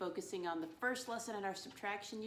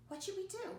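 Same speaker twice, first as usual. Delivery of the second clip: playful question; 13 semitones higher than usual. Acted. Teacher asks puppet playfully.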